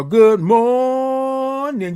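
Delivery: high, long-held "morning", stretched on the first syllable; big high stretched-out morning greeting; drawn-out greeting. Real, not acted. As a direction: sing out "good morning", holding "mor-" very long. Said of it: "I love all the O's and R's and I N Gs".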